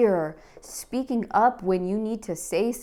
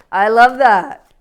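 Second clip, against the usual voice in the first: much louder and higher burst of delight; excited outburst; 4 semitones higher, 13 dB louder than usual. Real, not acted. Tarot reader gushes over the empowering card, loud. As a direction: blurt out your delight.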